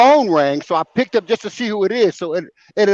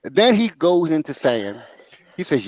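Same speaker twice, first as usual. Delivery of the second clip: ordinary talk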